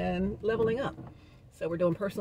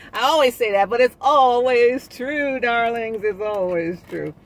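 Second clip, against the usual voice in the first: playful emphasis; 6 semitones higher, 9 dB louder, slower than usual. Acted. Playful emphatic tag to viewers.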